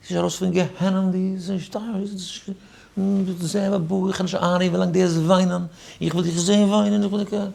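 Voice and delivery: high-pitched far-off voice of the accuser; retold angry man. Man accusing him at the simcha, high-pitched mock voice.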